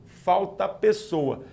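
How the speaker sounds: ordinary talk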